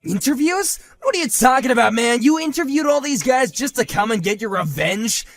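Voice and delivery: loud, high disbelieving outburst; can't believe what he's hearing; incredulous shouting. Acted. Husband shocked at the rival's interviews, incredulous shout.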